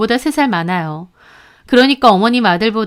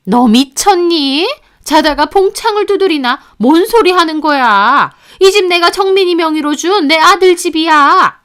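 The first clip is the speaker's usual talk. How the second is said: higher, louder, incredulous mother-in-law; indignant disbelief; 6 semitones higher, 4 dB louder than usual. A narrator's reading. Mother-in-law insisting the house is hers, indignant.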